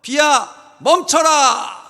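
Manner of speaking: high, loud shout with a long drawn-out finish; thundering acted rebuke; angry command. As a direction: order the rain to stop at full voice. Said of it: "막 화가 나야 돼요".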